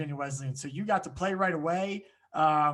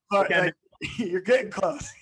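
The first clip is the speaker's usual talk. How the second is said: teasing and laughing, a bit louder and slow; 3 semitones higher, 5 dB louder than usual. Real, not acted. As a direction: laugh through it as you tease the player. Coach teasing player's dive habit.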